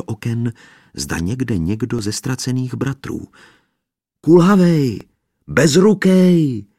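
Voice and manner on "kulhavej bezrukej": high loud call to all sides. A narrator's reading. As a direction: shout the names out into the yard. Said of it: "volal do všech stran". (volání do všech stran)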